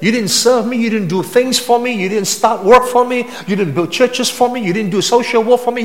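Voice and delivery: mock quote of Jesus. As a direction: build the list as Jesus might have said it. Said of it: "He didn't say that".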